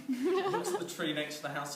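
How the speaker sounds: high giggle then words through laughter; laughing while talking. Real, not acted. Teller describing the cat lady at the tree, laughing through it. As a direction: fight the giggles to get the line out.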